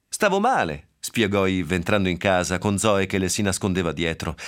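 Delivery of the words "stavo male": pleading. Acted. Yves defends herself in quarrel.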